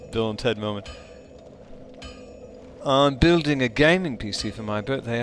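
ordinary talk, nothing marked

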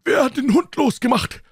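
put-on high, loud, trembling old man's cry for help; old servant shouting for help as the dog attacks; panicked old man. A narrator's reading. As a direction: stagger in crying out in terror. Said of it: "trat taumelnd aus ... und rief".

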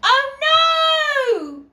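usual speaking voice

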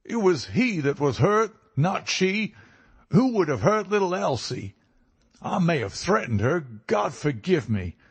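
high, anguished American protest; impassioned outburst, pitched well above normal; fierce cry. A narrator's reading. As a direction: plead and accuse fiercely in an American accent. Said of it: "he cried fiercely".

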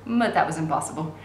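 ordinary delivery

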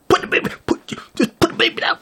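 squeaky high sputtering nag; rapid, spitting, high-pitched mimicry. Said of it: "So she raised up her voice".

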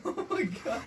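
high cracking laughter; helpless laughter. Real, not acted. Reader refusing to act out the moan, laughing. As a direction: laugh too hard to finish. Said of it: "do the laugh properly".

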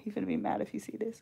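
secretive whisper; a quiet aside whisper. Real, not acted. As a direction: hush it so he won't hear. Hiding it from husband.

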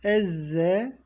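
ordinary talk, nothing marked

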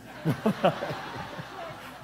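big real laugh at his own joke. Real, not acted. Pastor laughing hard at his own joke.

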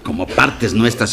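ordinary talk, nothing marked

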